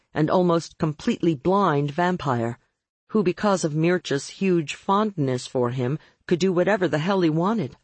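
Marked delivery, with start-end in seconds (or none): none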